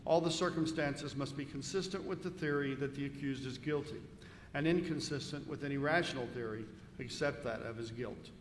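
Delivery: ordinary delivery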